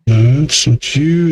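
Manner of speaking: ordinary talk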